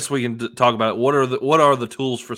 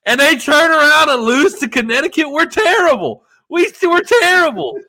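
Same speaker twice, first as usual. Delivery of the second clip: high, hammed-up wailing fan lament; mock distraught fans; 13 semitones higher, 5 dB louder than usual. Fan mocking his own team in a high distraught wail. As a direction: whine it like a heartbroken fan. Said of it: "and they're like".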